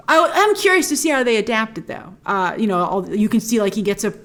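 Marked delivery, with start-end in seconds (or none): none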